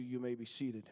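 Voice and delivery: normal talking voice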